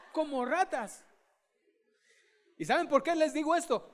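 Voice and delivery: ordinary delivery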